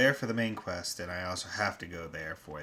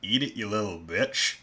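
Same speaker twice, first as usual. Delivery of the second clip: loud urging; 2 semitones higher, 6 dB louder than usual. Real, not acted. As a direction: push it out loud, egging on. Urging game character to eat.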